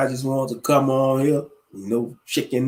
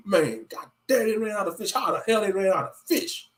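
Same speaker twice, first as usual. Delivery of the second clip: muttered complaint; 6 semitones higher than usual. Retold or imitated. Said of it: "I was like".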